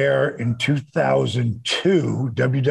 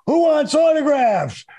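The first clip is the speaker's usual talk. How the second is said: high shouted tease; mock heel shout; 11 semitones higher than usual. Heel wrestler taunting waiting fans, high mock shout. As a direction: yell it out to bait the fans.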